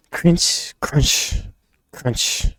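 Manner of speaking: high, whispery crunches; voiced crunch sound effect. Reader sounding out a fight scene, voiced crunch effects. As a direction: crunches trailing downward in pitch.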